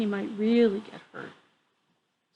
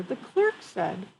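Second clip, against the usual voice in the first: plain reading voice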